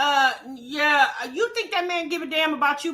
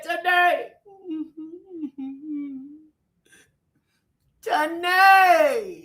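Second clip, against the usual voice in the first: hammed-up sobbing call of her name; mock crying; much slower than usual. Mocking a man crying to his girlfriend, hammed-up sobs. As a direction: wail the name in mock grief. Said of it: "he just broke down crying".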